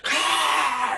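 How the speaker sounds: high, loud monstrous shriek; a vampire lunging with a shriek; priest's lunging hiss-scream. Acted. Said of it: "he goes".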